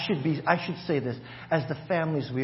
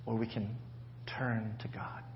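whispered; 5 semitones lower, 9 dB quieter than usual. Acted. Sermon climax, dropped to whisper.

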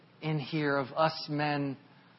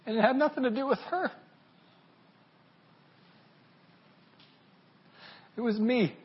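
voice breaking; 6 semitones higher, faster than usual. Real, not acted. Confessing failure, voice breaking.

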